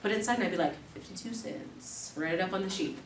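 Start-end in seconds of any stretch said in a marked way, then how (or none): 0.9-2.1 hushed tally. Retold or imitated. Quietly tallying savings.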